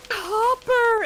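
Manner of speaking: high, loud gushing call of his name; delighted call. Retold or imitated. Vet tech greeting the cat she loves, a delighted high call.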